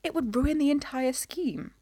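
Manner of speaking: high-pitched lofty reproof; reproving. A narrator's reading. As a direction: chide her as if it were obvious. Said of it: "Missus Stanley said reprovingly".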